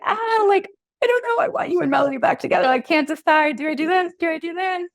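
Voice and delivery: high pleading whine; high fretful client voice; mimicking indecisive clients.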